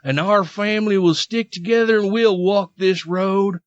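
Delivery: Southern pious read, slowing toward the end; mock Southern accent. Host quoting Chrisley on the good Lord, mock Southern accent.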